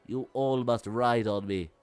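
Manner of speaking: imperious, forceful villain impression; Lord Frieza. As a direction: attempt a commanding cartoon tyrant. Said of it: "I cannot do the voice".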